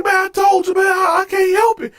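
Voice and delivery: high-pitched pleading imitation; defensive plea. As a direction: plead your case in mock panic. Cellmate pleads his condition.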